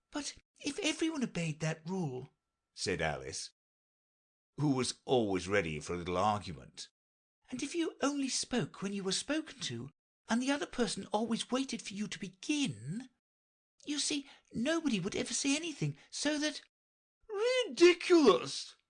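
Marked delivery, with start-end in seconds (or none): 0.1-2.3 put-on high, light girlish voice; Alice voice. A narrator's reading. Alice to herself, dreaming of being queen, in a high girlish voice. 7.5-16.6 put-on high, quick arguing girl; Alice arguing. A narrator's reading. Alice arguing with the Red Queen, high and quick. 17.3-18.7 very high, loud slow outburst; indignant cry. A narrator's reading. Red Queen crying out at Alice, loud and high. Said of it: "cried the Queen".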